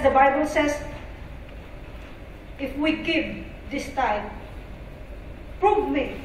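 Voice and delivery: normal talking voice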